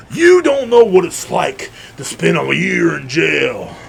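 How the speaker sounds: slow, drawn-out melodrama; hammed-up lament. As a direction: stretch it out like a mock-tragic veteran. Mimics dramatic ex-inmate cousin.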